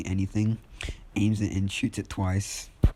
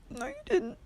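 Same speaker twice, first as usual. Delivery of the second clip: tiny, very high-pitched fake sob; mock crying; 4 dB quieter than usual. Kirishima protests to his attacker, mock crying. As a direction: squeak it out as a hammed-up weepy protest. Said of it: "crying, he says".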